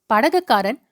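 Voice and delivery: ordinary delivery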